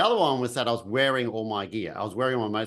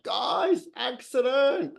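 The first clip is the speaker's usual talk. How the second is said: retold yell; 12 semitones higher, much slower than usual. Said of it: "I just yelled out, top of my voice".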